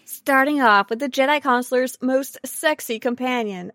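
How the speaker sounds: ordinary delivery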